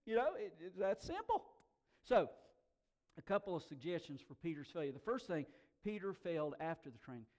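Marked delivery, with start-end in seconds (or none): none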